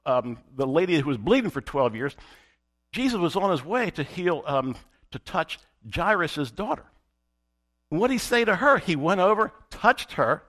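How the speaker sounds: normal talking voice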